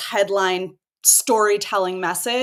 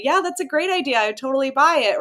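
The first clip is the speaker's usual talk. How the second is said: mock-eager agreeing mom, pitched higher; hammed-up cheerful mom approval; mom's polite yes; 6 semitones higher than usual. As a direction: play the supportive mom who says yes to everything. Mom flattering a product idea.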